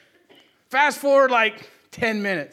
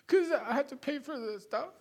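crying answer; 6 semitones higher, 8 dB quieter than usual. Retold or imitated.

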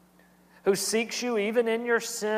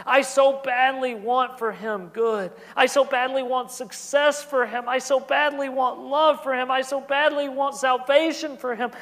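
higher and louder, pleading for his son; passionate preaching; 5 semitones higher, 4 dB louder than usual. Acted. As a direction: pour out a father's longing from the pulpit. Preacher's passionate list of wishes.

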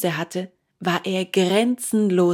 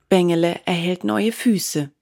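plain reading voice

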